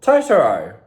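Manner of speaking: loud call across the house. Real, not acted. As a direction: call the dog loudly. Calling the dog loudly.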